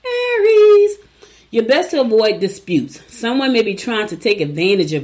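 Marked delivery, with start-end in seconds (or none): 0.0-1.0 slow, high, louder sign call; drawn-out announce. Acted. Host announcing the next sign in a slow drawn-out call. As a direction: stretch it out like an announcer.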